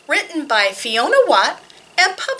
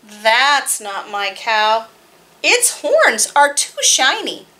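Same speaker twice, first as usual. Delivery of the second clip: put-on reading to children; slower than usual. Reads board book to children.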